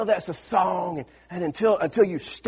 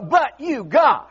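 "but you, God" shouted, pitch climbing; preaching shout; 4 semitones higher, slower than usual. Acted. A preacher rallies the congregation with a full shout. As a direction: drive the refrain home to the room. Preacher building call-and-response.

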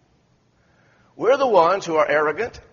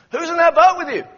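puzzled call; 10 semitones higher, 6 dB louder than usual. Retold or imitated. Fisherman calls from passing boat.

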